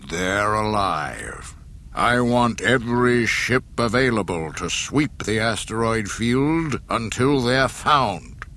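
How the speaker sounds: cold, slow command through a mask; Darth Vader voice. Acted.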